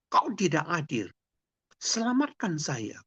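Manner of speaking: high-pitched, protesting cries; a desperate, angry plea to heaven; angry cry to God. Acted. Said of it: "Lidwina berteriak-teriak, marah kepada Tuhan".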